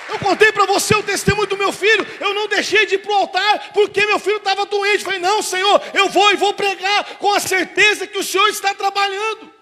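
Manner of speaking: loud, high, driving sermon climax; full-force preaching. Acted. Pastor rouses the congregation to trust God, in full-force preaching.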